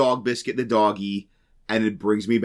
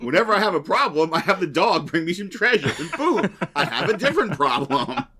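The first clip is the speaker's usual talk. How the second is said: high, quick, laughing through the quote; laughing parody quote; 7 semitones higher than usual. Retold or imitated. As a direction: giggle out the parody line. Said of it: "And it's like".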